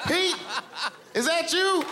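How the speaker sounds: surprised. Acted. Surprised to see Pete.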